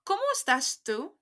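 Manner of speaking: "¿Cómo estás tú?" again, rising at the end; repeated model for the class; model for repeating. Shown as a demonstration. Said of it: "Repeat after me".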